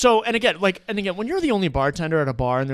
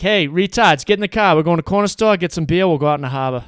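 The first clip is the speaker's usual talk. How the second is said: Boston accent; 3 semitones higher, 8 dB louder than usual. Shown as a demonstration. Local picking up friends for a beer run, Boston accent. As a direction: call out to friends in broad Boston. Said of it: "It's more like this".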